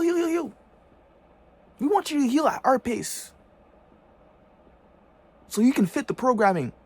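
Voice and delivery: high, loud dismissive mock voice; sneering high parody. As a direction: play the uncaring oppressor, shrill and loud. Continues the mock voice.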